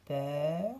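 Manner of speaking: Peur said with an open eu, mouth opened; open eu sound. Shown as a demonstration. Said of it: "J'ouvre un peu la bouche".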